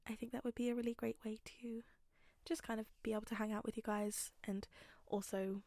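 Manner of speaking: soft whispered aside. Real, not acted. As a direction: drop to a whisper. Unvoiced stretch in outro.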